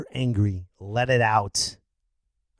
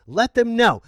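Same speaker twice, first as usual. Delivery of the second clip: loud, higher exhortation; rousing urging; 9 semitones higher, 9 dB louder than usual. Real, not acted. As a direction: urge them on at high volume. Urging listeners to yell.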